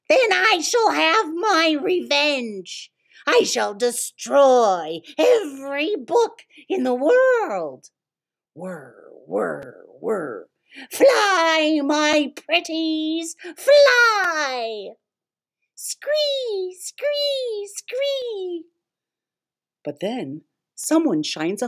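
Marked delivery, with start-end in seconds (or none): 0.1-7.9 high, slow gloating villain; villain revenge. Acted. 8.5-10.5 low whirring machine noise; whirring robot servos. Acted. 10.9-15.0 high, loud villain cry; witchy villain cry. Acted. Villain releases moths. 15.8-18.7 very high screeching cries; moth screech. Acted.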